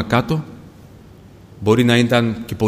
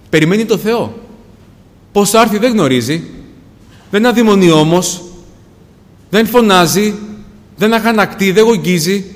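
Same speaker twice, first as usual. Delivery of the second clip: raised pitch and volume, emphatic sermon; impassioned preaching; 6 semitones higher, 5 dB louder than usual. Acted. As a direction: preach it with rising conviction. Preacher builds on the woman's waiting.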